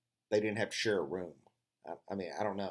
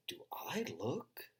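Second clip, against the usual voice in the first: slow, drawn-out mock offense; mock indignant; 4 semitones higher, 6 dB quieter, slower than usual. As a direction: pretend to be deeply insulted. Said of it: "And I said".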